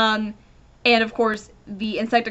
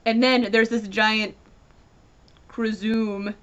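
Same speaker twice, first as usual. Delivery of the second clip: ordinary delivery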